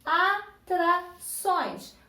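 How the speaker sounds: "atrações" slow, in three separate beats; syllable by syllable. Shown as a demonstration. Teacher asking pupils for the strongest syllable, "atrações" in separate syllables. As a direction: sound out "atrações" slowly so each syllable stands alone. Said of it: "Qual sílaba tem o som mais forte?".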